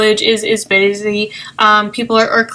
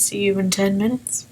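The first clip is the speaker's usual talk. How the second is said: much softer, intimate goodbye; soft parting line; 2 semitones lower, 8 dB quieter than usual. Acted.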